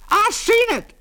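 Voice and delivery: very high, loud shrill cry; shrill shout. A narrator's reading. Old eyewitness blurts to the constable, shrill shout. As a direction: pipe up at the top of the voice. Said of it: "shouted old Mose shrilly".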